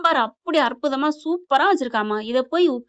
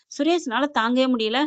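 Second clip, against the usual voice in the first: ordinary talk, nothing marked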